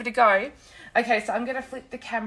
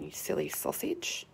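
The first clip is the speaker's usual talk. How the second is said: whispered; 8 semitones higher, 6 dB quieter than usual. Real, not acted. Scolding the phone playfully.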